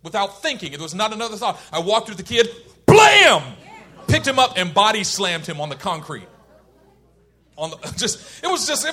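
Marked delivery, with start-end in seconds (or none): 2.9-3.4 sudden shouted "blam"; explosive, high mock slam noise; loud impact noise. Telling how he slammed the kid, explosive shout.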